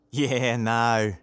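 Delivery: drawn-out mock Australian; attempted Australian accent. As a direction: have a playful go at an Australian accent. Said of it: "he has an Australian accent".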